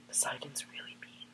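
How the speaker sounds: soft whispered gossip; secretive unvoiced whisper; whispered aside. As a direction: lean in and whisper it so the god won't hear. Gossipy aside about a god.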